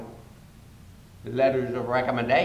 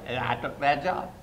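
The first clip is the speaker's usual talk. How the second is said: ordinary delivery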